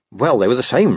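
ordinary delivery, nothing marked

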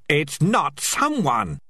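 slow, tense hiss of a reply. Acted. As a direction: hiss it through the teeth. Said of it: "he hissed".

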